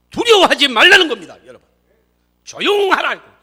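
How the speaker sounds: high, forceful preaching shout; shouted exhortation. Real, not acted. Preacher telling congregation not to fear, shouted exhortation. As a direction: drive the command home loudly.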